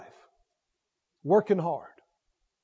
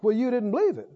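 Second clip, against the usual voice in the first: ordinary talk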